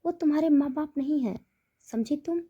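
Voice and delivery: a mother-in-law cutting her off hard; stern harsh voice. A narrator's reading. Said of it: "कड़क आवाज़ में बोली".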